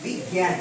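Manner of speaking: বিজ্ঞান said low with a nasal g; nasalised g. Shown as a demonstration. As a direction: say বিজ্ঞান with the g through the nose. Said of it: "কেমন উচ্চারণ হচ্ছে?". (বিজ্ঞান-এর গ নাকি সুরে)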